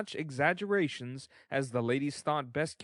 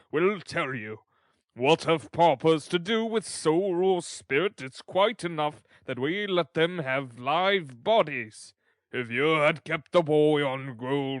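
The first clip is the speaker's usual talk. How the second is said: loud, orating official voice; blustering parish officer laying down the law; pompous, stern beadle; 6 dB louder than usual. Acted. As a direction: lecture the lady with self-important weight. Figure of Mister Bumble.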